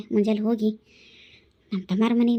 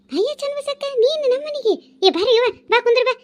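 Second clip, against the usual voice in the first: much higher, delighted greeting; surprised welcome; 12 semitones higher, 5 dB louder than usual. Acted. Neighbour greeting the surprise visitor at her door, delighted. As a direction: welcome an unexpected guest warmly.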